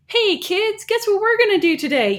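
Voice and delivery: excited teacher, high-pitched and loud; Miss Frizzle. Acted. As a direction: call out to the kids with bubbly enthusiasm. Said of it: "more Miss Frizzle vibes".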